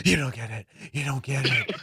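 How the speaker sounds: low demonic movie-star rasp; Nicolas Cage. Retold or imitated. Recounting his friend turned demon, low Nicolas Cage impression. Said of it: "he was talking like Nicolas Cage".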